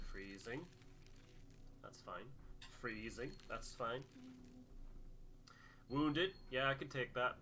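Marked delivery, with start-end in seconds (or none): none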